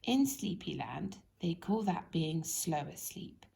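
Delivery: usual speaking voice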